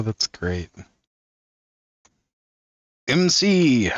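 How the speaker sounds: normal talking voice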